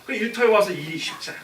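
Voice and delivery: usual speaking voice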